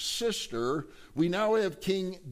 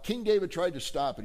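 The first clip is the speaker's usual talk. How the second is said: ordinary talk, nothing marked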